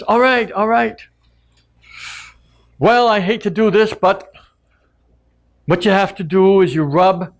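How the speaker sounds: high squeaky bird voice giving in; frightened bird. Acted. Caught bird pleading with Maui, high and squeaky. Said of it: "And the bird says".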